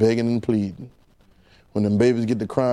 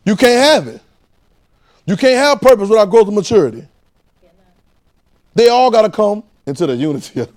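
higher, louder preaching; 8 semitones higher, 7 dB louder than usual. Acted. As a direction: press it on the congregation. Preaching at full force.

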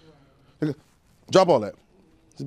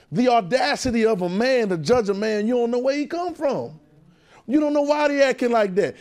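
raised, forceful preaching voice; impassioned sermon delivery, pitched up; emphatic preaching; 6 semitones higher, 3 dB louder than usual. Real, not acted. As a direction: preach it hard against judging others. Preaches against judging others.

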